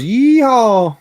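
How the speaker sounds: high, loud whooping yeehaw; mock Texan cowboy holler. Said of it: "he always goes".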